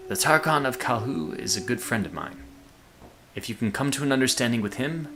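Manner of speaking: lightly put-on game character voice; a hint of an unplaceable accent for another character; different character voice. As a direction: voice him a little apart from yourself. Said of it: "give other people a different voice".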